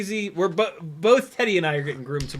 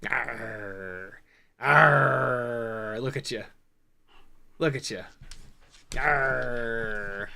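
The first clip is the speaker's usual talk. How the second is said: playful growl; 4 semitones lower, much slower than usual. Real, not acted. Growling play with dog.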